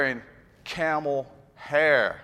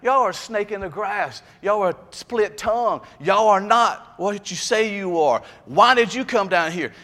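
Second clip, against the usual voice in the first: high, forceful prophet's rebuke; John the Baptist rebuking; 6 semitones higher, 4 dB louder than usual. Retold or imitated. John the Baptist confronts the Pharisees, higher and louder. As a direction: hurl the accusation at the Pharisees. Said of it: "He's meaning, like".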